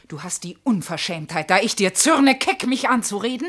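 ordinary delivery

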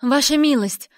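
loud, high alarmed call; anxious servant calling out; urgent shout. A narrator's reading. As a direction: cry out through a closed door. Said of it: "Выкрик Тальмы под дверью".

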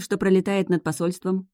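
plain reading voice

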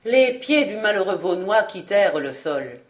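usual speaking voice

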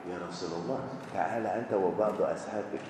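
hushed, secret plea; whispering in his ear. A narrator's reading. Wife to Prophet, hushed secret request. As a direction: lean in and murmur it softly. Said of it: "dia bisikin di kupingnya".

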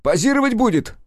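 very high, loud shrill cry; jealous outburst. A narrator's reading. Nikitin accusing Rudnev over Katerina, furious high cry. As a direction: fling it in his face.

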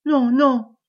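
loud, alarmed double no; feigned terror. Acted. Old woman keeps husband back, affecting growing terror. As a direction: protest loudly with put-on fright. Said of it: "en affectant une terreur de plus en plus croissante".

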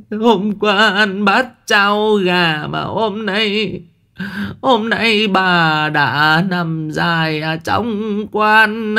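high, slow, drawn-out funeral wail; keen theatrically over the coffin; hired wailing. A narrator's reading. Woman wailing over old Phùng's coffin, high and slow. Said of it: "bắt đầu nó khóc giống cả lên".

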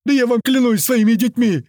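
high, loud panicked wailing; terrified wail. A narrator's reading. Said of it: "завопил Толстый". (испуганный вопль, высоко и громко)

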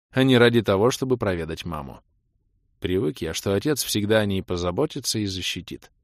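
ordinary delivery, nothing marked

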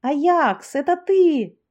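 high, loud happy shout; joyful shout. Acted. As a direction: yell it out in glad surprise. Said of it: "радостно заорала".

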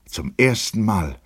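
plain reading voice

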